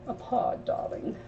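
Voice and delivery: a breathy diva 'darling'; mock-glamorous aside, lightly done; posh put-on voice. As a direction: toss it off like a grand dame. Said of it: "I don't know why I said it like that".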